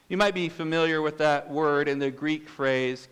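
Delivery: usual speaking voice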